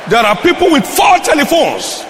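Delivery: high-pitched pulpit exclamation; exclaiming preacher. Real, not acted. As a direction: cry it out to the congregation. Preacher decries excess telephone spending.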